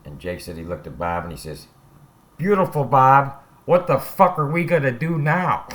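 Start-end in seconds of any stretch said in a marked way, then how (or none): 2.4-5.7 loud, high, frantic cry for help; panicked yell. Retold or imitated. Robber yelling at Bob in panic after the shotgun fires, loud and high. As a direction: shout it in a panic at your partner.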